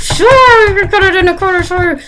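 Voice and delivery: very high, loud, strained mock politeness; put-on high-pitched customer-service front; fake agreeable outside voice. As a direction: squeal it out, over-accommodating. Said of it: "On the outside, I'm just going".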